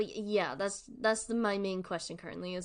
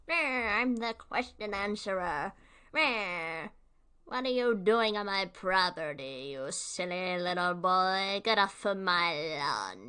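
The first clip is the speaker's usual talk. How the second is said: growly put-on villain voice, louder, old man shooing kids; grumpy cartoon villain; 4 dB louder than usual. Headcanon voice for the question answerer, cranky put-on villain. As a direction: bark it like a cranky cartoon baddie guarding his lawn. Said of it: "That's my new headcanon voice".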